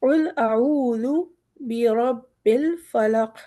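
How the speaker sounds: बिरब्बिल with a thick रा and ज़ with tongue out; heavy ra. Shown as a demonstration. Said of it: "रा मोटा है". (रा मोटा पढ़ें)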